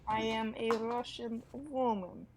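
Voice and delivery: slow amateur Russian accent; hammy Russian accent attempt; put-on foreign accent. Shown as a demonstration. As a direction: draw it out in a mock Russian accent. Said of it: "I'll read this one in a Australian".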